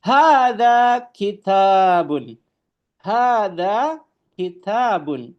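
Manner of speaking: slow, high, careful Arabic recitation of "Hadza babun"; Arabic model reading. Shown as a demonstration. Teacher reading Arabic to students, slow and emphatic. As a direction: drawl out "Hadza babun" for the class to copy. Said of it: "Ini adalah sebuah buku".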